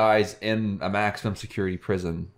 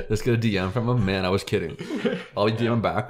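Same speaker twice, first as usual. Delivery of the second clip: ordinary talk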